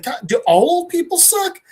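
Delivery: exasperated, high-pitched outburst; fed up, pitch jumping way up; acted teenage-style complaint.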